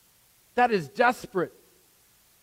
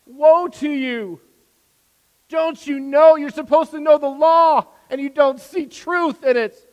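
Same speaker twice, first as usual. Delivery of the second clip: loud and much higher, a pained cry on the edge of tears; anguished lament; 8 semitones higher, 12 dB louder than usual. Acted. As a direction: grieve over the Pharisees as you cry out Woe. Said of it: "saying that through tears and anguish".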